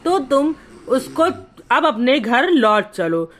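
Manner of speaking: usual speaking voice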